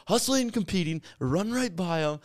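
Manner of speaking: high, punchy then slow; fired-up coach. Retold or imitated. Coach telling his team, high and fired up. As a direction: hype it like a pep talk. Coach's pep speech retold.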